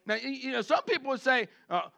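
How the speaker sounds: usual speaking voice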